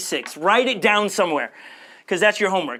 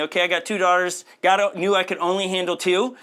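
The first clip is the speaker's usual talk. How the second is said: ordinary delivery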